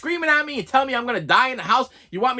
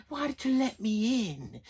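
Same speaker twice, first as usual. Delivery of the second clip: put-on slow, soft, eerie question from a sinister old man; lightly voiced menacing stranger at the door; creepy preacher voice; 2 semitones higher, 7 dB quieter, slower than usual. Imitating Kane at the door.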